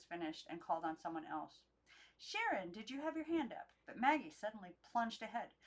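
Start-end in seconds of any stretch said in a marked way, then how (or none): 2.2-3.7 lightly voiced teacher. Teacher calling on pupil.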